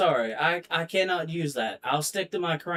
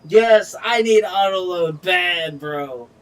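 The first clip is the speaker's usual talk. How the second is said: much louder, emphatic shout; loud outburst; 3 semitones higher, 11 dB louder, slower than usual. Real, not acted. Gamer to viewers, loud shout about wanting auto load. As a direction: shout the need out.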